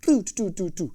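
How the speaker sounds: quick repeated mouth clicks; drum tick imitation. Shown as a demonstration. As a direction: sound out the stick hitting the head. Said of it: "Vous voyez ce que je veux dire?".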